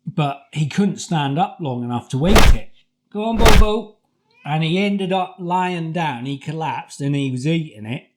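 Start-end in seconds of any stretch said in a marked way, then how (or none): 3.1-4.0 loud, high coaxing call. Retold or imitated. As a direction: urge the dog on, loudly. Coaxing sick dog to eat.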